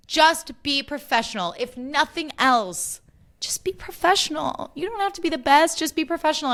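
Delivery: high-pitched exasperated pleading; worked up. Real, not acted. Podcaster lecturing the hosts on their job, worked up. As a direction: insist on it, voice climbing. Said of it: "I know I'm getting worked up".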